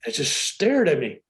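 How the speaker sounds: ordinary talk, nothing marked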